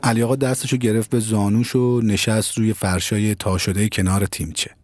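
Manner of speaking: ordinary delivery, nothing marked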